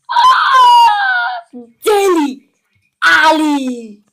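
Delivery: loud, high excited cry of the name; excited shout. Real, not acted. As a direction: yell it out thrilled. Excited pack reveal.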